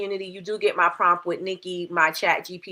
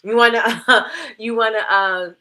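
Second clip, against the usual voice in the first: retainer in, laughing at it; wearing a retainer; 7 dB louder than usual. Real, not acted. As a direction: stumble around a new retainer. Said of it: "I got my new retainer in".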